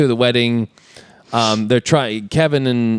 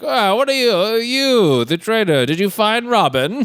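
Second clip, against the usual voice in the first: high, hammed-up villain questioning; sheriff impression; 9 semitones higher than usual. Sheriff questions the captured traitor, mock villain voice. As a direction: play the sheriff interrogating, pitched up.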